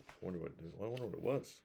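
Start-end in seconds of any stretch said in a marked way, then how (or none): none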